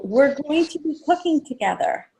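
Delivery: announcing to guests. Shown as a demonstration. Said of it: "I also tell people".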